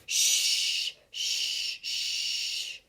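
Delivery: soft unvoiced shh, shh, shh; repeated whisper. Wonka keeps silencing the room, whispered shh shh shh. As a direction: keep hushing in a whisper. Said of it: "who kept saying".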